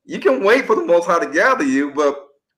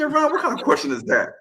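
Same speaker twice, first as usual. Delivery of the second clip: high-pitched retold disbelief; scandalized, pitched-up quote; incredulous friend; 11 semitones higher than usual. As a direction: imitate a friend baffled by the question. Friend retold scoffing at naive question.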